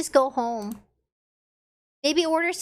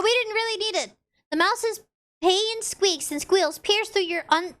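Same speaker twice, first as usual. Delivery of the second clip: ordinary delivery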